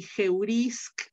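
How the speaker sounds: geurisk spelled out slowly, every sound distinct; slow teacher demo of geurisk; careful slow model. Shown as a demonstration. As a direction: say geurisk slowly so students hear each sound. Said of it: "teacher models Greek word slowly".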